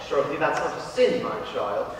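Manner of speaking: low, soft confessor's voice; reassuring priest. Acted. Priest reassures the young penitent, low and soft.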